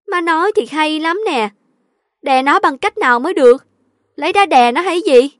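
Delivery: high, loud daughter talking back; exasperated retort. A narrator's reading. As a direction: challenge mother in frustration. Daughter retorts to her mother. (cãi lại đầy bực bội)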